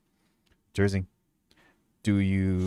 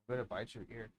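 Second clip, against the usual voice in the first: hushed teasing; 19 dB quieter, faster than usual. Real, not acted. Teasing the dog softly.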